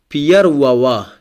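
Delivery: первого with the unstressed ending said wa, ПЕР-во-ва. Shown as a demonstration. Teacher showing learners that первого ends in an unstressed wa. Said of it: "O is unstressed".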